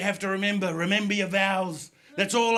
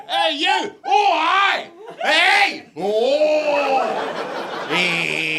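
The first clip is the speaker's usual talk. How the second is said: high, loud mock-Glaswegian holler; imitation Scottish street shouting; yelling back in kind; 7 semitones higher, 7 dB louder, much slower than usual. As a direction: yell back in nothing but vowels. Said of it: "you wanna respond in kind".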